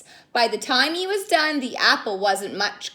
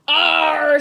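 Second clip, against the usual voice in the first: grumpy growl; 4 semitones higher, 8 dB louder than usual. Acted. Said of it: "said Kyle".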